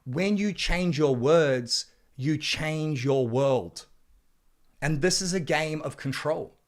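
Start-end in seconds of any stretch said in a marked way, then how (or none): 0.0-3.9 drawn out slowly, word by word; slow emphatic slogan. Acted. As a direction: let each word land slowly. Delivers key slogan.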